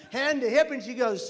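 usual speaking voice

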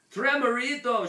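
high, loud cry of alarm; panicked shout. A narrator's reading. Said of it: "shouted Poquito Tito, the panic-stricken".

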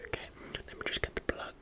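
usual speaking voice

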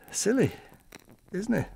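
high, sing-song self-mockery; self-mocking. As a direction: laugh at his own naivety.

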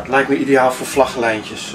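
normal talking voice

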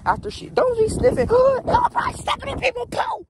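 very high-pitched squawking shout; alarmed yell. Real, not acted. Owner stopping dog sniffing poop, high alarmed yell.

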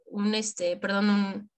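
ordinary delivery

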